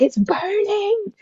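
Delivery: high, drawn-out wail; imitated squealing complaint of pain; retold pain. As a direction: whine it slowly and high, as if it hurts. Retold pain while pushing.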